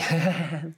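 small high put-on giggle; a mom's little giggle to soften the blow; imitated little giggle. Imitating her mom after a blunt remark, little high giggle. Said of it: "little giggle after she says it".

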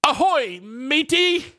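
high, hammy pirate greeting. Pretend. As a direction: belt it out like a cartoon pirate. Pirate speak on Talk Like a Pirate Day.

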